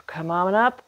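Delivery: usual speaking voice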